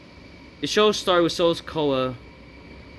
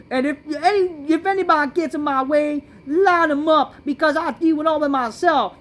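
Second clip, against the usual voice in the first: high, loud mock wrestler promo; mocking Jacob Fatu; 9 semitones higher, 4 dB louder than usual. Vlogger mocking a wrestler's promo, high and loud. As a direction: ham up his promo, way up high. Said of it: "then we see that he said".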